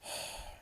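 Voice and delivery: weary breathy exhale; long sigh of exasperation; exasperated sigh. Real, not acted. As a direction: let out a fed-up sigh. Fed up with the bad story.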